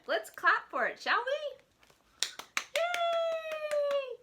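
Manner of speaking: high, rising cheer. Acted. Librarian getting kids to clap, high cheer.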